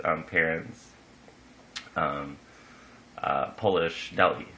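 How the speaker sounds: ordinary talk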